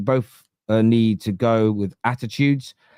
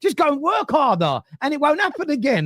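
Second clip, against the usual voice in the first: inner shout; 12 semitones higher than usual. Retold or imitated. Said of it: "I'm like".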